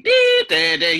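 very high, loud beeping "dee"; piercing hearing-test beep imitation; imitated machine beeps. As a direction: mimic shrill test tones, stepping along.

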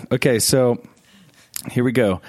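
ordinary talk

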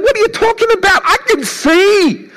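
high, frantic protest; panicked servant. Retold or imitated. Servant protesting to Elisha that they are surrounded, high panicked voice. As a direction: plead in a high, scared voice. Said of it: "the servant is saying".

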